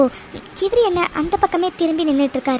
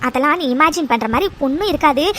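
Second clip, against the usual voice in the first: normal talking voice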